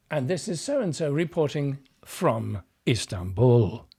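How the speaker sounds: imitated old BBC sign-off with a marked sentence ending; old-school BBC correspondent. Actor showing how correspondents ended reports, old-school BBC sign-off. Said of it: "older school B B C correspondents".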